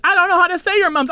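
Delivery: high, quick imitated protest; mock flustered excuse. Friend admitting he can't translate, high mock fluster. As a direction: squeal out a helpless excuse.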